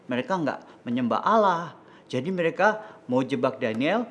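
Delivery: ordinary delivery, nothing marked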